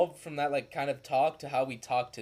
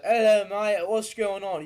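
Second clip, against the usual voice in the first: higher, louder chirpy British greeting; British accent; 7 semitones higher, 6 dB louder than usual. Retold or imitated. Showing how other cultures talk, British accent. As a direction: greet like a cheery Brit, mate. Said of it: "you can either talk like".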